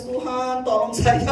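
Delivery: usual speaking voice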